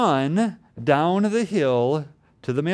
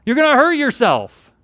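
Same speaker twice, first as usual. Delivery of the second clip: put-on scolding a child; 8 semitones higher, 6 dB louder than usual. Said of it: "scold him and say".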